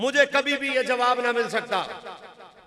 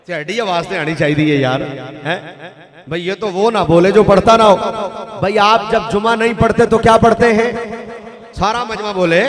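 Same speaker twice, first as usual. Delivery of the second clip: loud preacher prodding the congregation; मजमे को जगाना; 11 dB louder than usual. Real, not acted. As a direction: call out so everyone answers. Rouses crowd to answer louder. (बुलंद आवाज़ में मजमे से जवाब मांगना)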